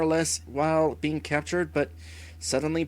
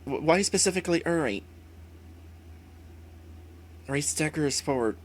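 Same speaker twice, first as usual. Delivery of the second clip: usual speaking voice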